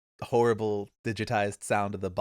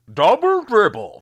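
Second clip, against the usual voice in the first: high, loud, tinny sports game announcer; retro digitized stadium announcer; 13 semitones higher, 14 dB louder than usual. Retold or imitated. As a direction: shout it like an old console voice sample. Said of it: "the most horrible digitized voice sample".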